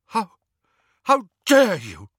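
loud, high outrage; livid. A narrator's reading. Lupin answering Harry's accusation, livid and loud. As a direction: burst out in stunned fury. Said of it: "Lupin's livid face".

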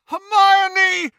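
high, desperate bellow. A narrator's reading. Ron calling to Hermione upstairs, bellowing. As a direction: bellow the name in anguish. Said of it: "Ron bellowed".

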